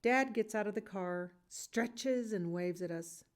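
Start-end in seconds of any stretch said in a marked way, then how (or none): none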